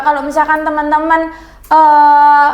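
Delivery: usual speaking voice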